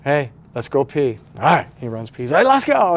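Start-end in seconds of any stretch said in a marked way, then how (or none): 0.0-1.2 louder, coaxing call to a pet; rally the dog to head out. Retold or imitated. Owner inviting his dog outside to pee, loud and encouraging. 2.3-3.0 much higher, loud rallying call; excited dog call. Retold or imitated. Owner calling his dog to run outside, excited and loud.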